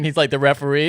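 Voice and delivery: ordinary talk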